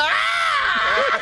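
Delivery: high drawn-out mock scream; retold scream. Brother retelling his fright at seven, screaming high. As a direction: scream back as your scared younger self. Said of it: "I said".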